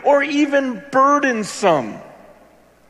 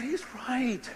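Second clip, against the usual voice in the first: whispered; 13 dB quieter than usual. Real, not acted. Quiet realization.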